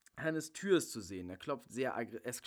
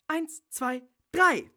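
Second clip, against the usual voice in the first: building up to a much louder, higher yell on drei; countdown to a shout; 12 semitones higher, 12 dB louder, much slower than usual. Acted. Counting down the attack.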